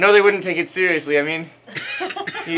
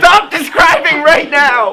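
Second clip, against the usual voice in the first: higher, louder and slower, half laughing; laughing shout; 10 semitones higher, 8 dB louder, slower than usual. Real, not acted. Reader protesting the fanfic description, slow laughing shout. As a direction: drag out the demand while still laughing. [name]'s reader begs narrator stop.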